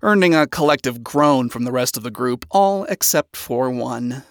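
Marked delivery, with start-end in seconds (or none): none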